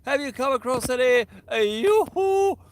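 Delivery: high, loud falsetto sing-song call; Janice greeting. Retold or imitated. Angler voicing viewer Janice greeting the stream, high cheery call. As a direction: chirp it like a cheery lady waving hello. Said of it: "Janice joins us".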